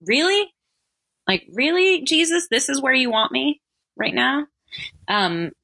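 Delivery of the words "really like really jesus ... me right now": high, incredulous questioning of God; half-joking, sarcastic "are you serious?" tone; mock disbelief, pitched well up; joking exasperation. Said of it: "I was kind of joking".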